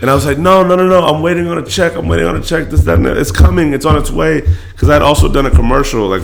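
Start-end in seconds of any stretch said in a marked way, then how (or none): 0.4-4.4 higher, urgent protest; pleading. Retold or imitated. Son retells brushing off his mom's help, higher and urgent. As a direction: wave her off insistently.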